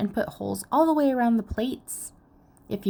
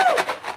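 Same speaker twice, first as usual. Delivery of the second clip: excited woo. Acted. Celebration after countdown.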